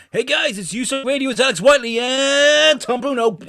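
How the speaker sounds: high, loud, hammed-up DJ hype; American radio DJ. British host parodies American radio intros, over-the-top hype. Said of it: "Americans have a very distinctive radio style".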